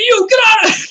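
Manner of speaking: high, fast yell of alarm; sudden high shout, get out; startled shout. Retold or imitated. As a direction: yell him out of the store. Retold character yelling at guy.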